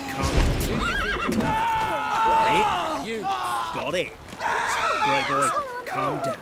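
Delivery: laughing high yell. Pretend. Streamer hollering at the horse in a high laughing yell. Shouting repeated line, laughing.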